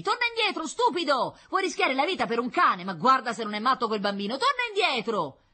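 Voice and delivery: loud, high, scolding yells; angry shouting. A narrator's reading. Firemen scolding the boy risking his life, shouting. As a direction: bawl out a reckless kid from afar. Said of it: "gridavano i pompieri".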